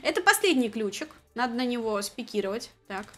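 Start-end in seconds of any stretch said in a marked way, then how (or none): none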